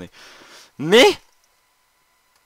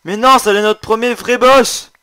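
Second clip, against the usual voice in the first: angry outburst; 11 semitones higher, 15 dB louder than usual. Real, not acted. Upset the boss despawned.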